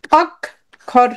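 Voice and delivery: অক and খর as two separate beats; broken into syllables. Shown as a demonstration. Teacher counting syllables with students, অক - খর split apart. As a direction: say অক, pause, then খর. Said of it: "আমরা ভেঙে উচ্চারণ করি".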